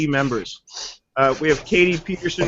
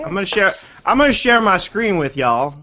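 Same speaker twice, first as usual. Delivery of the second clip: put-on Southern accent; 3 semitones higher than usual. Host showing off to colleagues, playful Southern accent. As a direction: play up a Southern accent on y'all. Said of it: "I was always pretty good with a Southern accent".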